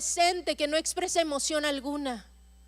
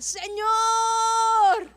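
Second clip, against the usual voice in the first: raised, higher cry to God; 4 semitones higher, much slower than usual. Acted. Preacher voicing the desperate boy, raised cry. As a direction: call out to the Lord in despair. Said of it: "empezó a gritar".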